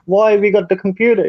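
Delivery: high-pitched mock puzzlement; questioning skit character. Accomplice questions the bank robber, high mock-puzzled voice. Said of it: "the Indian bloke that walks in and goes and robs the bank".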